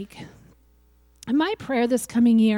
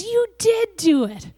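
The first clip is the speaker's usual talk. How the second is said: cheering yell; 8 semitones higher than usual. Retold or imitated. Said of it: "He yells and says".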